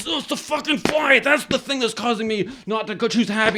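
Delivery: high, mock-furious outburst; irritated outburst. Failed meditator raging at a buzzing fly, irritated. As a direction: blow up over a tiny annoyance, pitch jumping up. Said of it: "the slightest irritation is always just pissing you off".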